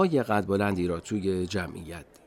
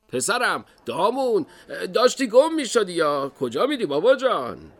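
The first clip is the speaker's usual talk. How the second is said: loud, raised-pitch dad's voice; father to son; 11 semitones higher, 7 dB louder than usual. Acted. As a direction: call your straying child back, loud and high. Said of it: "پدرش بود. گفت". (پدری با صدای بلند، خطاب به پسرش)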